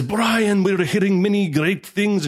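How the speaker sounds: high, slow, eager broken English; imitating her broken English, slow and high. Ukrainian pastor's wife greets Brian in eager broken English. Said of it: "she was very broken English".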